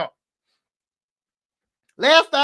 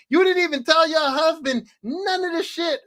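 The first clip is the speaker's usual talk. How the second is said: normal talking voice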